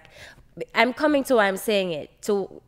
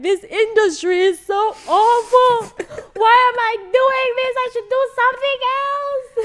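high, loud, half-laughing wail of despair; mock sob through a laugh, hammed up; crying despair; 9 dB louder than usual. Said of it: "I was so devastated, and I cried".

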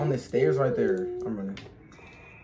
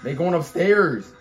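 scared horror game streamer; 4 semitones higher, 11 dB louder, slower than usual. Real, not acted. Sees monster moving.

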